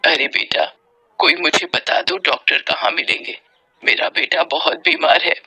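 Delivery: low, breathy, anxious plea; hushed, weary begging for help; pleading mother. Acted.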